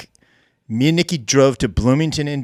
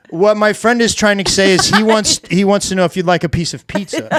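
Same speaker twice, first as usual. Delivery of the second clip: interpreter voice; 5 semitones higher, 4 dB louder than usual. Retold or imitated. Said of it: "I was interpreting for him".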